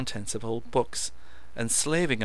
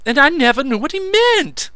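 excited; 14 dB louder than usual. A narrator's reading. Meadows raving.

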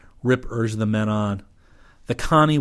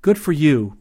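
raised, louder exclamation; exclaiming; 5 semitones higher, 7 dB louder than usual. A narrator's reading. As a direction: cheer him on out loud. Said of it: "Rip exclaimed".

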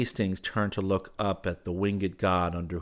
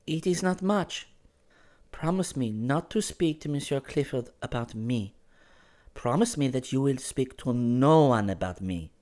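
put-on higher, measured girl's voice; gentle pleading girl; 7 semitones higher than usual. Girl asks him to promise silence, gently pleading.

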